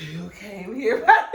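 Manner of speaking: retelling with breathy, overdone H on "here"; mock slurred come-on with "here" heavily aspirated; hard H's. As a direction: puff a heavy H into "here", stretched slowly. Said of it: "why you saying all these hard H's".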